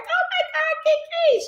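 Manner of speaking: high, drawn-out gushing greeting; excited squeal. Retold or imitated. Greeting Kiki, thrilled to see her, drawn-out squeal. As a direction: squeal her name with delight.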